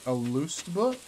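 Southern twang on book; country accent. Real, not acted. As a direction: rural drawl, stretching the vowel. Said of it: "Why'd I sound country?".

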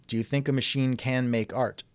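ordinary talk, nothing marked

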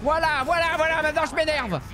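voix de fausset très aiguë, outrée et énervée; voix suraiguë exaspérée. Pretend. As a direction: couine comme un petit personnage furieux. Commente la bataille en voix aiguë. (voix de fausset exaspérée)

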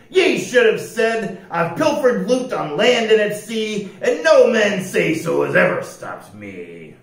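loud, boastful pirate shout. A narrator's reading. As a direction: shout it after him as a pirate captain. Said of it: "Pete shouted".

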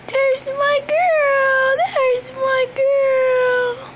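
high, slow crying coo; real happy tears; tearful. Real, not acted. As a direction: let the tears break through. Mother overcome with joy.

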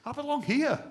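loud, high, rousing preacher's build; preaching at full force. Real, not acted. Pastor rallying his church, full-force preaching.

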